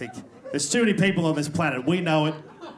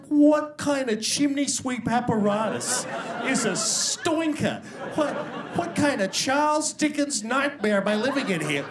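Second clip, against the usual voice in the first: appalled inner reaction; 7 semitones higher than usual. Retold or imitated. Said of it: "And I was like".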